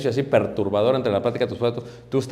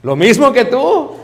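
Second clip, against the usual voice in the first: higher, louder, slowed evasive answer; bluffing husband; 9 semitones higher, 9 dB louder, slower than usual. Retold or imitated. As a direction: stall and fake agreement.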